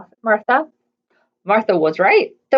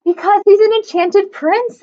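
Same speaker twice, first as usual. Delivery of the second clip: higher, lightly louder insistence; haughty princess; 8 semitones higher, 4 dB louder than usual. A narrator's reading. Arabella defending the frog to Martha, higher and louder. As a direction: insist with a lifted voice. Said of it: "said Arabella".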